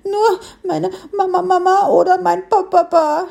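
high little piglet voice, sure of itself; convinced piglet. A narrator's reading. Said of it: "setzte es überzeugt hinzu".

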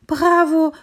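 bravo called out high and loud, delighted; cheering bravo. Acted. Little tree cheering the hare's first salto, loud excited call. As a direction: cheer the hare on at full voice. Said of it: "rief das Bäumchen".

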